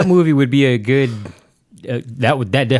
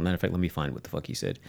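muttered aside; 6 semitones lower, 15 dB quieter, faster than usual. Real, not acted. Muttering to himself while searching.

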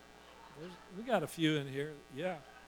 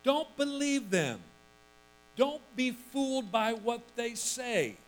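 1 Paul insisting; 6 semitones higher than usual. Retold or imitated. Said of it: "He said".

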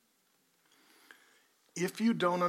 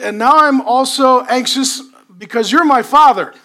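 ordinary talk

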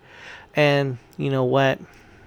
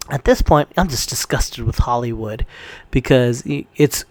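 ordinary talk

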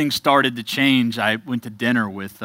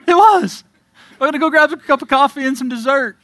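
much higher and louder, laughing, fast; laughing delight; 14 semitones higher, 9 dB louder than usual. Real, not acted. Pastor reliving a sober night out to his congregation, laughing and excited. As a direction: burst out with giddy joy.

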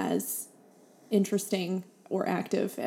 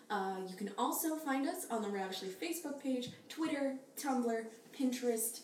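ad read; 11 dB quieter than usual. Acted. Sponsor read.

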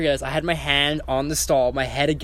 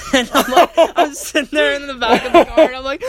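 loud, much higher voice breaking into laughter; laughing; 11 semitones higher, 8 dB louder than usual. Real, not acted. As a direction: laugh out the words, barely holding it together. Laughing hard while telling.

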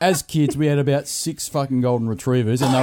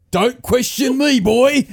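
slow, high, raised-voice rebuke; stern dad; 8 semitones higher, 4 dB louder, slower than usual. Retold or imitated. Dad rebukes son for defending mum, mock stern yell. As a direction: imitate a father laying down the law, drawn out. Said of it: "He goes".

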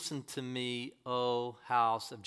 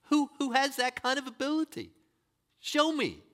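challenging cry; 9 semitones higher, 3 dB louder than usual. Real, not acted. Preacher challenges idol makers.

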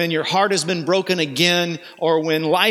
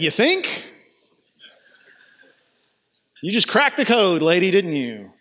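sarcastic quip. Pretend. Joking sarcastic Jesus line.

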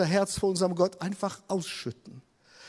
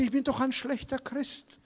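soft, higher-pitched self-reproach; lightly voiced depressed wife; 6 semitones higher, 6 dB quieter than usual. Depressed wife confessing to her husband, soft higher self-reproach. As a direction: quote her softly in a small, raised voice. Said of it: "Sagt sie".

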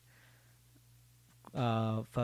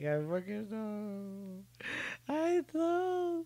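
high, slow, wailing I know; mock wail; 9 semitones higher, much slower than usual. Vlogger joking about his broken heart, mock wail. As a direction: whine it out like a heartbroken mock sob. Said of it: "I was just like".

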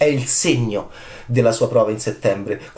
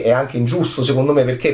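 ordinary delivery

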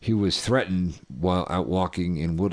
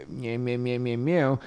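cat meows; 4 semitones higher than usual. Pretend. Random cat noises mid-story.

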